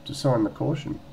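hint of a policewoman impression; policewoman on phone. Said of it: "She goes".

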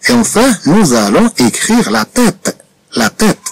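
very slow, each word spaced for writing; slow dictation. Shown as a demonstration. Teacher dictating the next word to pupils, very slow. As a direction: dictate it slowly to the class.

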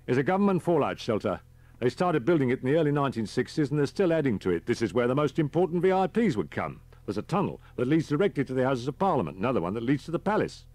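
high, loud, quick character voice; driver as tour guide. Acted. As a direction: rattle off the facts like an eager guide.

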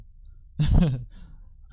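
loud, gleeful burst of laughter; big pleased cackle, tailing off; satisfied laugh. Real, not acted. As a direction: laugh out loud at your own success. Pleased with in-game trick.

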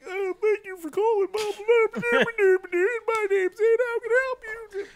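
very high strained squeal; comically squeaky agent voice; strained phone greeting. Retold or imitated. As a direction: gabble a greeting mid-stretch, squeezed and high. Answers call caught mid-stretch.

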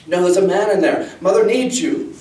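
high, loud, insistent pleading; desperate insistence. A narrator's reading. Girl contradicting father who says all is fine, desperate insistence. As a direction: contradict urgently, pitch climbing as you plead.

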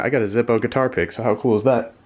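usual speaking voice

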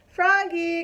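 loud, high call after him; mother calling Froggy back a second time. Acted. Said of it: "called his mother".

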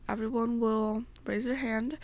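ordinary delivery

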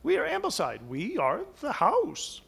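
a hint of a grand old house speaking; lightly voiced house declaring itself. House tells the portrait who it is, lightly voiced.

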